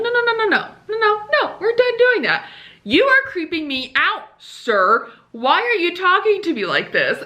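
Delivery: a touch of mock indignation; exasperated refusal. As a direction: shut him down, fed up. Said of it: "And I'm like".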